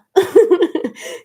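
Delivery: a loud, high laugh. Real, not acted. Laughing after cutting off a curse. After censoring "get your sh.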